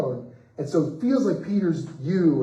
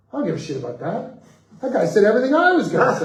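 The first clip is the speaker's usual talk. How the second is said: much higher, louder, fast and laughing; delighted outburst; 10 semitones higher, 10 dB louder, faster than usual. Retold or imitated. Fan in line telling the writer, excited and laughing. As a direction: burst out that he felt exactly the same.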